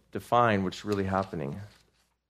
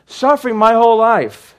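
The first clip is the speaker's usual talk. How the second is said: loud, high, pained psalmist; anguished cry; 12 semitones higher, 10 dB louder than usual. Acted. Preacher voicing the suffering psalmist, loud and anguished. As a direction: cry it out like a man in endless pain. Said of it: "to him it seems like an eternity".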